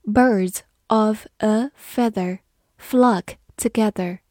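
Birds of a feather read slowly, word by word. Shown as a demonstration. Teacher reading slowly so students repeat after her. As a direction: read it slowly for learners to follow. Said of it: "好，我们慢慢来读".

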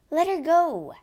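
high, loud commanding shout. A narrator's reading. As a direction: shout it across the water. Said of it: "shouted the Count".

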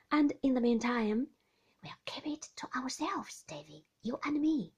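fond nurse sharing a secret plan; Peggotty confiding. Acted. Peggotty confides her marriage plan to David, hushed.

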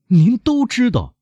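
very high, drawn-out accusation; high, fearful outcry; terrified cry. A narrator's reading. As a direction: cry it out in dread, slowly. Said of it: "莫雷尔高声地说， 处在极度的恐惧之中".

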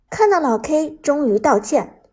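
ordinary delivery

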